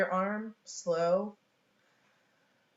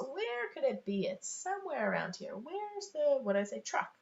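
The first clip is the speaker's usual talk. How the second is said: playful puzzlement; 6 semitones higher than usual. Acted. Parent hunts for hidden truck, playful puzzlement. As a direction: wonder aloud for a small child. Acting out searching for hidden object.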